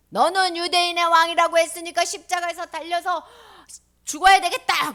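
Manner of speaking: high, forceful pronouncement; stern loud sentencing. Acted. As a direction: condemn someone at full voice. Judge pronouncing the death sentence.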